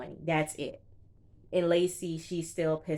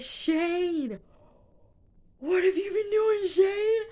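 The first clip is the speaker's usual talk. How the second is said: high, loud scolding yell; shouting at Shane over the failed test; 6 dB louder, slower than usual. Retold or imitated. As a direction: shout his name in disbelief. Yelling at Shane over lie.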